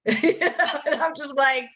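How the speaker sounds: laughing it out, high and slow; breathy amused laugh. Real, not acted. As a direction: giggle through the words. Laughing while telling story.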